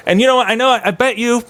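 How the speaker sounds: high, excited parody of a man; mock scheming male. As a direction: pitch it up as he hatches his plan. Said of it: "So I'm a male".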